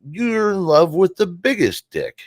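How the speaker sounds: a hint of Dad teasing from beyond; dad's voice. Son hearing late father in the mirror, hint of dad's voice. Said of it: "I hear my dad's voice".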